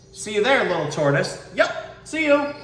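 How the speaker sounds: high, bright passing call; mama calling. Acted. Mama passes little tortoise, high cheery goodbye.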